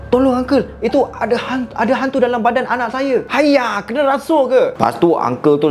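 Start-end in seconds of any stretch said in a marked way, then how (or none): 0.1-3.2 frightened pleading. Acted. Mother begging the neighbour about her possessed child, a high frightened plea. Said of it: "Ayu pun cakap". 3.3-4.7 startled uncle. Acted. Uncle reacts in Chinese accent.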